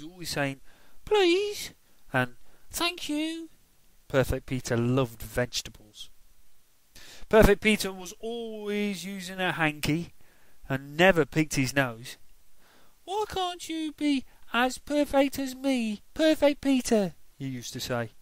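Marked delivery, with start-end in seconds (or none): 1.0-3.5 high, sweet goody-goody voice; prissy little boy. Acted. Perfect Peter being extra polite. 13.0-17.1 put-on high, sing-song taunting little boy; mocking child. Henry taunting his brother Peter.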